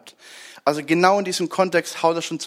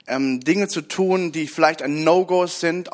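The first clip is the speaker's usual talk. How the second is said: ordinary talk, nothing marked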